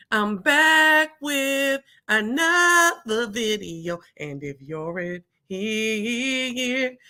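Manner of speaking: slow show-opener with long, swooping notes; drawn-out signature greeting. Real, not acted. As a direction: stretch each word out very slowly, holding the vowels.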